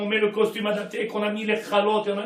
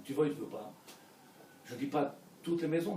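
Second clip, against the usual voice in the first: ordinary talk, nothing marked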